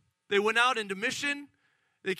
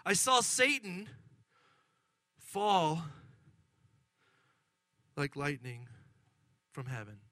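grave pronouncement; 4 semitones lower than usual. Acted. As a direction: let it fall solemnly to a hush. Jesus' sobering answer, first take.

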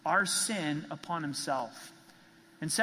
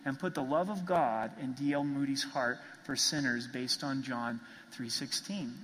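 ordinary talk, nothing marked

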